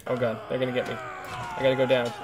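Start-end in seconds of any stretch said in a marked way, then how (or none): none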